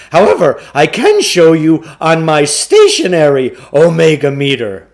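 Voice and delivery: a slick con man pitching the deal; booming carnival barker sell; pushy salesman. A narrator's reading. Klugarsh makes excuses.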